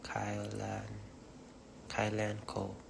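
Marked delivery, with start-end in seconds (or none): 0.0-0.8 name tried out in a whisper. Shown as a demonstration. Rehearsing her name for the camera, whispered first. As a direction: breathe the name once, testing it. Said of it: "Hello, the".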